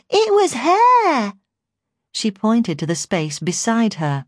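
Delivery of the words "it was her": put-on very high, slow, louder toddler voice; heightened baby voice. A narrator's reading. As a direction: insist like a baby, stretching the words. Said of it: "heightening her baby voice".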